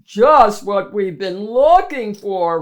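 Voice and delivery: loud booming monster voice; roaring monster. Acted. Biggest monster roaring at its new cave, loud. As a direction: roar it out like a giant beast. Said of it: "roared the biggest".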